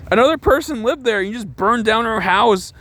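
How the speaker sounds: high-pitched, exasperated protest; indignant, voice pushed way up; incredulous outrage. Real, not acted. Reviewer appalled at the arson, incredulous.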